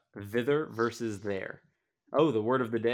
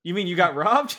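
mock correction; 8 semitones higher, 9 dB louder than usual. Family correcting mom in story.

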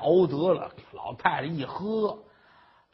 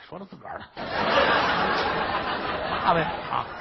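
plain reading voice

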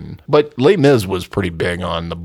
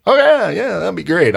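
mock eager; 10 semitones higher than usual. Retold eager reply to the request.

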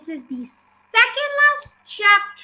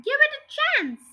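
high-pitched, insistent plea; cried protest; 9 semitones higher than usual. A narrator's reading. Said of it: "cried Mister Cranky".

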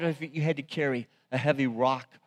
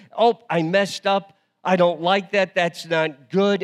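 higher and louder, mock annoyed at a bad drawing; frustrated doodler; 6 semitones higher, 8 dB louder than usual. Pastor acting out a bad drawing for the church, mock frustration. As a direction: play someone fed up with their sketch. Voices a frustrated Etch-a-Sketch user.

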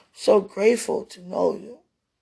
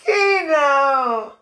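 high, loud cry; raised, ringing invocation; impassioned calling out; 7 semitones higher, 8 dB louder than usual. Real, not acted. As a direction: call out to a whole kingdom. Calling out to the kingdom.

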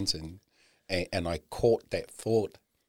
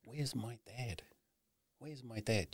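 daughter's voice; 4 semitones higher, 9 dB quieter than usual. Retold or imitated. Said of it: "Her voice came out really loud".

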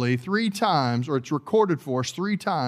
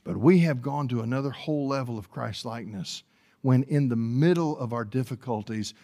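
ordinary talk

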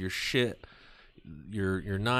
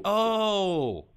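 mock realization; 12 semitones higher, 8 dB louder than usual. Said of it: "I was like".

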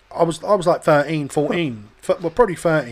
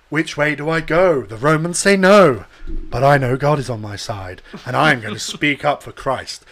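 overly elaborate acting; 2 semitones lower, 4 dB louder than usual. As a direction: play a holy hero in an overblown little play. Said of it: "like, overly elaborate shit".

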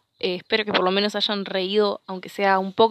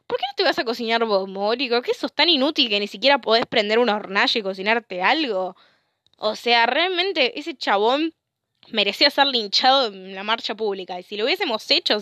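ordinary delivery

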